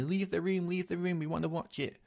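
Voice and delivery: high-pitched mock chanting yell; pestering siblings' high voices; imitated sibling shouting. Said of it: "would be shouting at me".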